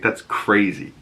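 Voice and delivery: ordinary talk, nothing marked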